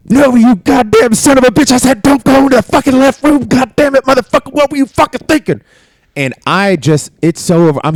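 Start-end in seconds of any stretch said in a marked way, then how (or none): 0.1-2.0 screaming very high and loud; drill sergeant screaming. Retold or imitated. Drill sergeant screaming in recruit's face, very high and loud. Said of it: "in my face like". 2.0-5.6 screaming very high and loud; drill sergeant screaming. Retold or imitated. Drill sergeant screaming in recruit's face, very high and loud. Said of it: "in my face like".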